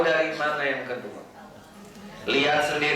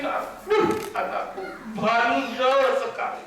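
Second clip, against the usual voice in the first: high, exasperated mother's sigh with a laugh; fretting mother; 10 semitones higher than usual. Retold or imitated. A mother sighing over her naughty child, high and exasperated. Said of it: "ini ada ibu-ibu mikirin".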